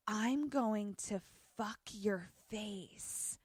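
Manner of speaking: low menacing line. Retold or imitated. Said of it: "Clegg says".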